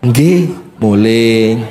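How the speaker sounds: low, slow submissive reply; meek obedient husband. Acted. Husband meekly obeying his wife, low and slow. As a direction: answer like a dutiful pushover.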